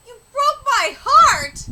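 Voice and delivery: slow, high, loud anguished cry; dramatic heartbreak. Acted. Dramatic rereading of the line.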